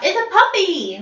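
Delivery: loud, high shout with a falling end. A narrator's reading. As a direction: shout it out, pitched right up. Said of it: "They shout".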